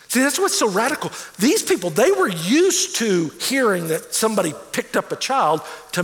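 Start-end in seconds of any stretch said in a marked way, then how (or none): none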